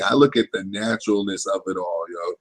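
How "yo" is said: usual speaking voice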